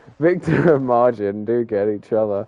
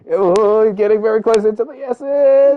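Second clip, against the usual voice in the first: higher, excited live commentary; excited race call; 8 semitones higher, 5 dB louder than usual. Real, not acted. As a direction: call the near miss with rising alarm. Live race, cars nearly collide.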